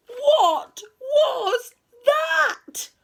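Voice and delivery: very high, loud, drawn-out wail; terrified cry. A narrator's reading. Friends panic at the third knock, terrified wail. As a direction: stretch every word out in alarm.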